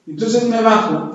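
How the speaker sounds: normal talking voice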